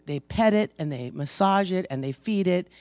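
usual speaking voice

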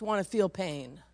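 normal talking voice

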